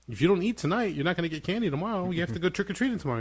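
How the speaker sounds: high, insistent retold warning; parental warning. Dad retells warning son about losing candy, insistent.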